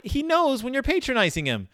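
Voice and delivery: usual speaking voice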